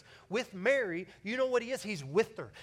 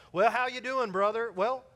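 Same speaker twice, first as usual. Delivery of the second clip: higher, louder playful voicing of a clumsy greeting; mock awkward exchange; 4 semitones higher, 6 dB louder than usual. Preacher mocking a clumsy greeting at a funeral, louder and higher. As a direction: act out the awkward chat. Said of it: "we're like".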